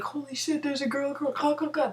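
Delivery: high mock excited drunk voices; drunk guys spotting her. Drunk guys spotting the girl watching, high mock excitement. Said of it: "they're like".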